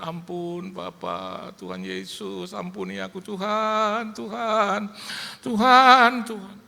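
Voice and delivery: high pleading that builds to a loud cry; crying out in prayer. Retold or imitated. Preacher re-enacting own prayer for mercy, building high desperate cry. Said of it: "seperti saya layaknya pernah berdoa gitu".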